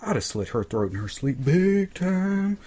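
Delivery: slow, put-on menace of a slasher; a hint of a hammed-up killer; mock killer voice. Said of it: "I'm like".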